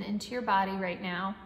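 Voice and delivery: calm, even breathing-coach voice; guided meditation. Acted. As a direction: guide the breath gently and steadily.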